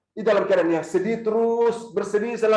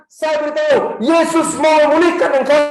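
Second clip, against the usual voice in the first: louder, higher, preaching to the crowd; preaching at full force; 6 semitones higher, 6 dB louder than usual. Acted. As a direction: proclaim it like a promise from the pulpit. Climax of the sermon.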